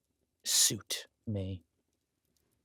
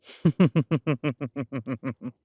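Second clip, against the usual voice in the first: loud, high cackling laugh; a big gloating villain's laugh; gleeful villain laugh; 4 semitones higher, 9 dB louder than usual. Acted. Villain gloats over his wife.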